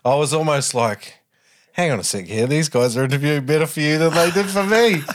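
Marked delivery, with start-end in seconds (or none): 1.7-5.0 rising, higher-pitched playful protest; mock indignation. Host teases his guest about better interviews, mock indignant. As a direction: build to a jokey outraged complaint. Acts out his own jealous reaction.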